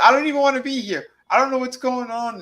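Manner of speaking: put-on high, fast whiny girl voice; high mock girlish whine; mocking the blonde. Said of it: "she goes".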